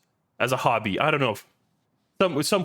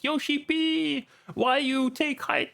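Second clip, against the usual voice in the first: mock fan yell; 13 semitones higher, slower than usual. Players blaming the game director, in a high mock yell. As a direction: whine it slowly in a squeaky complaining voice. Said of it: "people still fucking yell".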